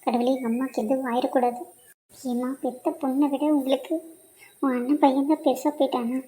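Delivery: guilty hushed aside. Acted. Daughter secretly begging forgiveness from her mother, hushed aside. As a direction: murmur the apology so mother can't hear.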